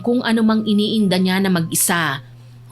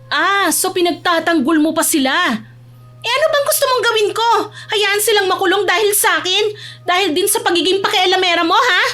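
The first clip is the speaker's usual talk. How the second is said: high, indignant retorts; heated quarrel; 10 semitones higher than usual. Acted. Two cousins quarrel over Abby's friends, angry. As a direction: argue back hotly, pitched way up.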